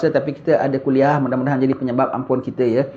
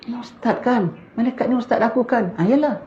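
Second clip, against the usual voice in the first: very high, startled voice of the dead; the dead student recognizing his ustaz, high and shocked; 10 semitones higher than usual. Retold or imitated. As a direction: gasp it out high in disbelief. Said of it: "Sekali dia tengok".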